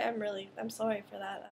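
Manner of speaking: ordinary talk, nothing marked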